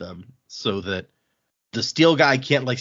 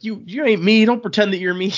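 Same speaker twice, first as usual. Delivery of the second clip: indignant Wayne; 10 semitones higher, 5 dB louder than usual. Retold or imitated. Wayne snaps at his imitator.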